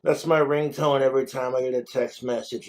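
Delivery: ordinary talk, nothing marked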